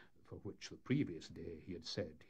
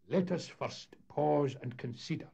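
raised, deliberate voice of a wary skipper; cautious; 8 semitones higher, 7 dB louder than usual. A narrator's reading. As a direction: urge the others to hold back. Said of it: "remarked Parahandy with his usual caution".

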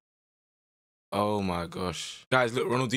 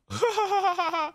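high squealing laugh; 3 dB louder than usual. Real, not acted. Gamer laughing at the Ronaldinho trial, high squealing laugh. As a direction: burst of high giggling laughter.

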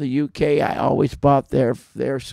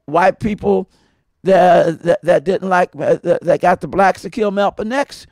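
higher and louder, jeering; loud mock taunt; 5 semitones higher, 7 dB louder than usual. Man voicing woman who insulted him, raised mock taunt. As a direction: retell her insult in a raised voice.

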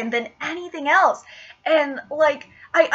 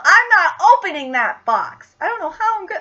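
usual speaking voice

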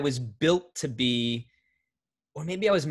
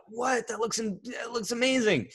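high, wide-eyed mock amazement; imitating impressed strangers; amazed onlookers; 12 semitones higher than usual. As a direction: gasp it out like a stunned passerby. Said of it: "People were like".

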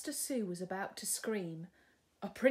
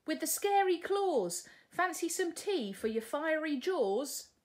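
higher, louder coaxing offer; cheeky princess; 8 semitones higher, 5 dB louder than usual. Acted. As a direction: tempt the dragon with tea. Sue's voice.

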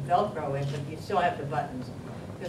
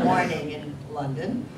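normal talking voice

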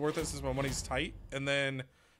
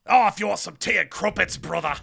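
loud, high mock British wrestler promo; British Hulk Hogan; 5 semitones higher, 9 dB louder than usual. Joking about British Hulk Hogan, loud mock British accent. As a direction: Hulk Hogan growl with a posh British twist. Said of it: "British Hulk Hogan".

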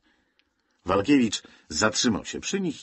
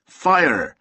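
sharp loud command, higher; officer's shout; 7 semitones higher, 7 dB louder than usual. A narrator's reading. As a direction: bark the order across the beach. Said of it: "krzyknął oficer". (okrzyk oficera, głośno i wyżej)

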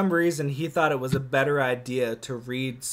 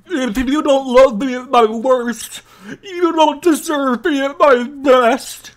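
monster voice; 9 semitones higher, 10 dB louder than usual. Acted. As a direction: belt it like a lovestruck monster. Monster declares its love.

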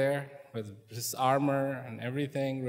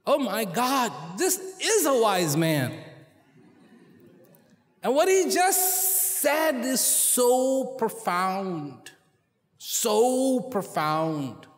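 slow, higher, lingering wonder; awestruck realization; 6 semitones higher, 4 dB louder, slower than usual. Acted. King realizing the wise man's words, slow and awestruck. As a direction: let the awe of the insight stretch out each word. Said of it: "He realized".

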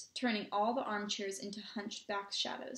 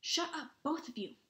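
higher, sharp hushing order; sharply; 6 semitones higher than usual. A narrator's reading. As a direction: cut off the bickering without shouting. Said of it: "said Harry sharply".

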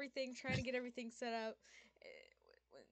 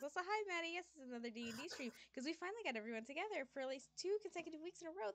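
ordinary delivery

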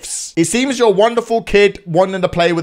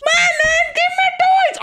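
put-on high demanding baby shout; slower than usual. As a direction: demand toys like a toddler.

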